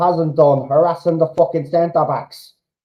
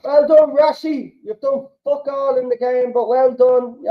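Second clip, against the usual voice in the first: high, mocking well done; sarcastic praise; 7 semitones higher than usual. Real, not acted. Fan mocking Rashi's game, sarcastic high praise. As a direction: praise him with a sneer.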